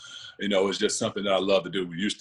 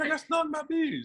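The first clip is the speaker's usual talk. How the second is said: high, whimpering complaint; hammed-up pained kid; whiny hurt kid. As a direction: whine about the stings. Retold self after bee stings.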